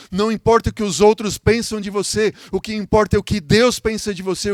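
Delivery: high-pitched, insistent preaching; pregação fervorosa. Real, not acted. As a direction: press the assurance home to the congregation. (pregação enfática, em tom agudo)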